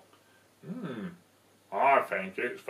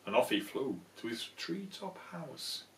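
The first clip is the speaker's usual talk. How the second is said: ordinary delivery, nothing marked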